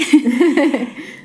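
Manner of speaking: a brief, bashful laugh; shy laugh. Real, not acted. Guest laughing shyly while introducing herself. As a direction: laugh it off, a little embarrassed.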